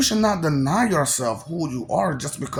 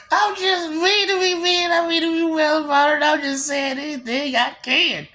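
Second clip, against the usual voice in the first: mock whine; 12 semitones higher than usual. Taunting in a put-on voice.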